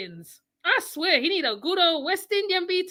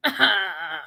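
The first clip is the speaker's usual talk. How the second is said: ordinary talk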